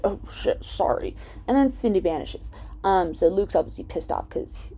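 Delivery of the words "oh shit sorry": half-whispered, embarrassed sorry; sheepish hushed apology. Retold or imitated. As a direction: mumble it, caught out. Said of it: "she's like".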